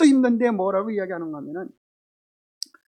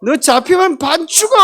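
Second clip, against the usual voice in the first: loud, higher, hammed-up Gyeongsang threat; mock threat; 6 semitones higher, 6 dB louder than usual. Shown as a demonstration. Gyeongsang husband mock-threatening his wife in a chase game. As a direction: growl it as a playful menace. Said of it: "그러면 어떻게 한다 그래요?".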